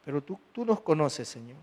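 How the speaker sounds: soft, hushed prayer to the Lord. Acted. Pastor praying softly over the congregation before preaching. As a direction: pray it quietly, head bowed.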